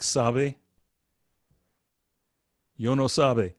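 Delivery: "sabe" with lips not quite closed on the b; soft Spanish b. Shown as a demonstration. Teacher compares with Spanish sabe, soft b. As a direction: soften the b in "sabe" toward a v. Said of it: "you don't quite put your lips together".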